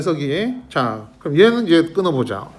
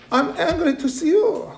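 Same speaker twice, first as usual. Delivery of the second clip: high-pitched, acted exasperation; mock angry; 8 semitones higher, 3 dB quieter, much slower than usual. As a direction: read it as the fed-up parent would. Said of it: "보기만 해도 화나!". (짜증 난 척 높은 목소리로)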